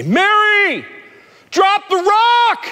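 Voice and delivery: high, slow shouted order; shouted command. Retold or imitated. Boat crowd shouting to the swimmer, slow high shout. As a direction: yell it slowly so she hears. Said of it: "she hears them say".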